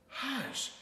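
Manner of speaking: high-pitched mama's hush; scolding. A narrator's reading. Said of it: "Mama scolded".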